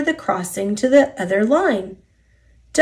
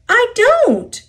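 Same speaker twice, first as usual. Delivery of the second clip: very high, louder protest; protesting denial; 5 dB louder than usual. A narrator's reading. Duck denies it to the engines, protesting harder. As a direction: escalate the denial, pitched right up. Back-and-forth quarrel in story.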